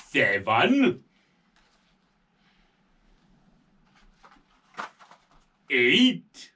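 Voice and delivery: put-on counting aloud slowly for kids; teaching a child to count. Shown as a demonstration. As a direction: count each number for a toddler. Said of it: "Can you count with me?".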